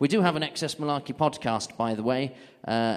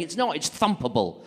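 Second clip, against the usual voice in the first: ordinary delivery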